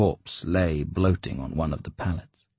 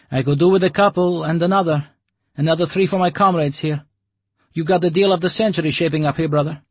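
higher, louder, fast sales patter; fast-talking broker; 11 semitones higher, 6 dB louder, faster than usual. Acted. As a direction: rattle it off like a hustler. Broker haggles.

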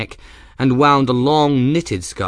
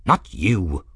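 testy; 3 semitones lower than usual. Acted. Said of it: "Ferris said in a testy voice".

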